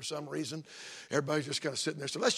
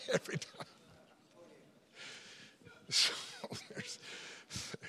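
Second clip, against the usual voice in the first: laughing; 11 dB quieter than usual. Real, not acted. Laughing at his own joke.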